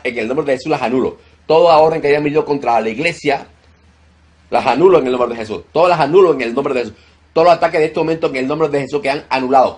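loud, high, commanding rebuke of demons; fiery deliverance preaching. Acted. Pastor commanding demons to leave the congregation, shouted. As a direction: cast the demons out at full force.

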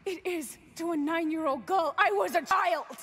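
pained shout; angry cry. Acted. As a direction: hurl it at him, the child's pain breaking out. Heated argument, shouting back.

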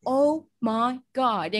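slow, drawn-out sitcom exclamation; sitcom catchphrase. Retold or imitated. Quoting a line memorized from Friends, slow and drawn out. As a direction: stretch each word out like a Friends catchphrase. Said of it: "I know that it sounds like".

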